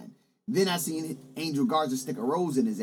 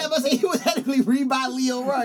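heated loud; 9 semitones higher, 6 dB louder than usual. Real, not acted. Heated argument about Vince.

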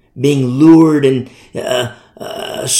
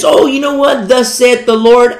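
higher, louder would-be prophet; mimicked preacher guy; 7 semitones higher, 6 dB louder than usual. Preacher guy prophesying to a follower, mock proclamation. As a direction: proclaim it high, like a showy preacher.

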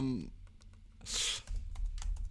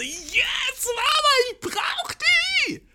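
put-on high, slow shrieking child; excited kid; much slower than usual. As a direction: beg like an overexcited child. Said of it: "alle Kinder waren so". (hohes, kreischendes Kind)

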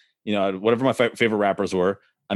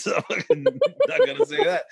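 laughing. Real, not acted. Laughing at own joke on podcast.

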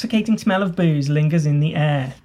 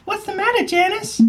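put-on high, conspiratorial old lady voice; a prim busybody spreading dark rumours; gossiping old lady; 14 semitones higher than usual.

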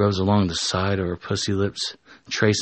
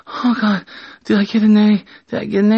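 high, loud put-on voice; high-pitched acted excitement; excited student voice; 13 semitones higher, 5 dB louder, faster than usual. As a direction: blurt it high and eager.

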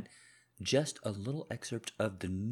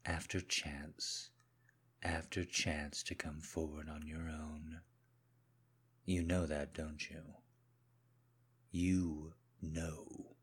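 controlled menacing husband; 3 semitones lower than usual. Acted. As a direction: speak low and controlled, as if you already know. Husband confronts wife, audio drama.

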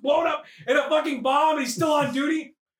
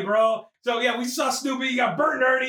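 ordinary talk, nothing marked

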